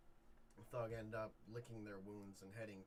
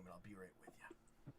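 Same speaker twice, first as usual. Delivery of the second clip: whispered aside; 3 semitones lower, 9 dB quieter than usual. Acted. Trina aside at the table.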